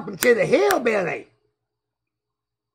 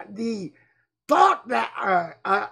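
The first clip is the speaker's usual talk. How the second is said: usual speaking voice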